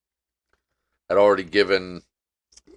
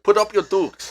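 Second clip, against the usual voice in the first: mock fighting challenge; 11 semitones higher than usual. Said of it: "I'm gonna be like".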